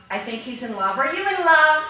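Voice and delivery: ordinary delivery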